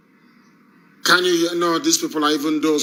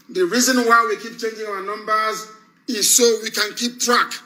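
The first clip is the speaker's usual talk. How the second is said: usual speaking voice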